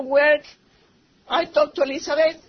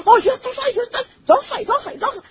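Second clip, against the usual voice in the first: higher, louder plea to stop fighting; mother retelling her son's loud plea to stop; son pleading to stop; 9 semitones higher, 5 dB louder than usual. Retold or imitated. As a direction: beg them to quit arguing, voice raised. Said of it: "And he said".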